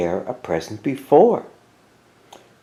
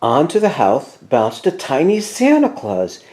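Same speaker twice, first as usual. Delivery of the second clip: plain reading voice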